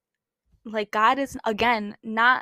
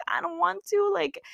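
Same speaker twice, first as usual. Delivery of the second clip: higher, a mock groggy whine at the alarm; whiny; 7 semitones higher than usual. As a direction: whine like you don't want to get up. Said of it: "I was just like".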